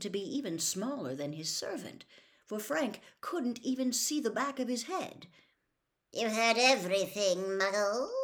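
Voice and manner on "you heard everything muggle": raised, icy villain voice; cold voice. A narrator's reading. Cold master questioning the Muggle Frank, icy and forceful. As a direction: confront the intruder coldly. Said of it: "said the cold voice".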